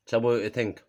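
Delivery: usual speaking voice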